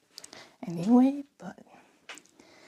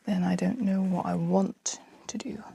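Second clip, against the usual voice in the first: whispering. Real, not acted. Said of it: "I don't know why I'm whispering so much".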